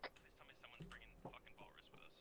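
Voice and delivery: hushed urgent whisper. Real, not acted. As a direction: breathe it out under fire. Quiet plea during extraction.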